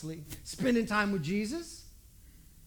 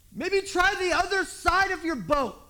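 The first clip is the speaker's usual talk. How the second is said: much higher, louder, slow mocking call; the stranger on the bank yells advice, much higher and louder; know-it-all shout; 10 semitones higher, 8 dB louder, slower than usual. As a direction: lob advice like a smug bystander. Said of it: "yells out to the guys".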